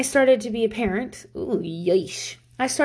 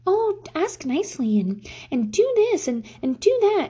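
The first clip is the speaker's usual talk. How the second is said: higher, sing-song mockery of a preachy magazine; mocking parenting advice; 9 semitones higher than usual. Mom mocking Parent Magazine's advice, sing-song and higher. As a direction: parody the magazine's nagging tips. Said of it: "anxiety-inducing book".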